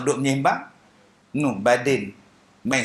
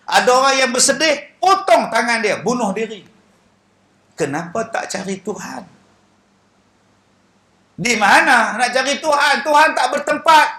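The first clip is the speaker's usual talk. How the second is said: raised, emphatic sermon voice; preaching; 9 semitones higher, 7 dB louder than usual. Acted. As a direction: preach it to the crowd with force. Preaching to congregation. (berceramah dengan bersemangat)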